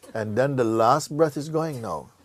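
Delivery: normal talking voice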